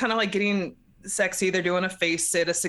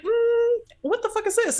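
very high, suspicious hum and question; mock wary; 14 semitones higher than usual. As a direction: balk at it, pitched way up. Heroine wary at creepy cave.

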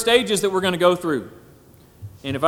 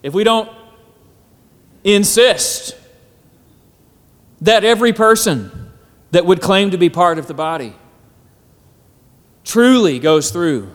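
preaching; 4 semitones higher, 4 dB louder than usual. Acted. Preaching at full force.

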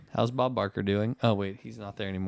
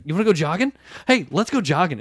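loud, high, goofy taunt; excited taunt; 6 semitones higher, 5 dB louder than usual. Retold or imitated. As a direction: bounce it like a jogging invitation. Naked guy taunting friend.

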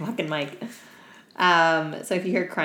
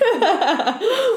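high burst of laughter; loud laugh; 8 dB louder than usual. Real, not acted. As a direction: crack up loudly. Big laugh after joke.

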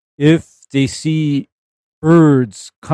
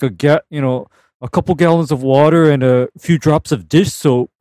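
ordinary talk, nothing marked

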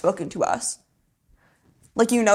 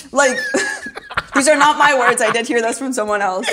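laughing, louder; 4 semitones higher, 4 dB louder than usual. Real, not acted. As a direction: laugh it off then press on. Admits borrowed words, laughing.

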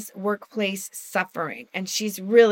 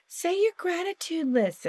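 mock preachy quote; 8 semitones higher, 6 dB quieter than usual. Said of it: "when people used to say".

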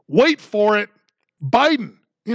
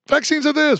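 much higher, sing-song mock; mocking high take; 7 semitones higher than usual. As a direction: toss it off up high. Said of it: "the contradictions from the left".

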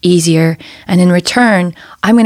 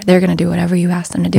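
normal talking voice

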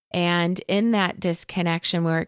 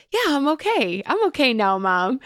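put-on higher, small child's voice saying he's fine; little boy; 7 semitones higher than usual. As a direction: play the soothed little son. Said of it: "until he was like".

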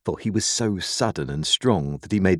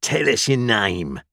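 slow, higher rough-spoken demand; demanding convict; 4 semitones higher than usual. A narrator's reading. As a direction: interrogate the boy as a menacing convict. Convict interrogates the boy.

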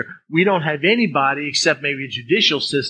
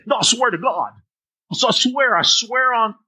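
higher, pleading insistence; insistent oath; 7 semitones higher than usual. Acted. Person doubted by a listener swears it's true, insistent and high. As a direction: swear it up high, desperate to be believed. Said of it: "look at 'em kind of funny and they say".

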